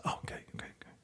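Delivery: jittery whispered okays; nervous whisper. Retold or imitated. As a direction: whisper it, tense and on edge. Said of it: "he's like on edge".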